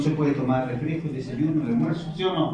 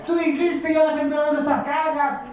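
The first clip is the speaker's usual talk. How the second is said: much higher, shouted complaint; angry people; 10 semitones higher than usual. Acted. Angry Israelites shouting at Moses, high pitched. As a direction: yell at Moses in outrage. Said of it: "la gente se enojó".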